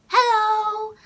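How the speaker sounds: high, loud, drawn-out greeting; high-pitched hello. Shown as a demonstration. Vlogger showing how she greets people online, high-pitched. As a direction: sing out a held, high hello. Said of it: "I'm like".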